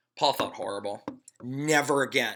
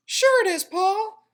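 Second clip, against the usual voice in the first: tiny, high, loud tempting voice; pigtail voice; 10 dB louder than usual. A narrator's reading. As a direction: lure him like a little devil. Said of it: "said the pigtail".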